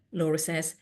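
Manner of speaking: ordinary delivery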